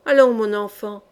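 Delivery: usual speaking voice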